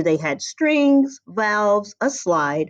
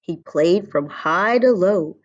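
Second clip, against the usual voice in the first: ordinary delivery, nothing marked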